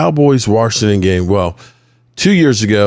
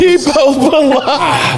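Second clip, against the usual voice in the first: drawn out through laughter; mocking the loser, slowly and gleefully; slow laughing taunt; slower than usual. Real, not acted.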